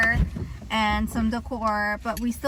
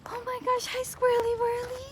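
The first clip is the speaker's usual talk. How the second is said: much higher, delighted cooing hello; squeaky squirrel greeting; 13 semitones higher than usual. Real, not acted. Spotting squirrels on the walk, delighted high coo. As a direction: greet the squirrel like a tiny pet.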